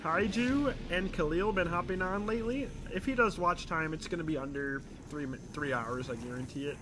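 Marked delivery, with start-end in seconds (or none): none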